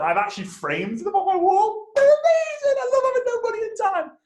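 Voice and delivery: very high, squealing fake enthusiasm; mock delight. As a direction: gush sarcastically in a soaring voice. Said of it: "No one ever says that".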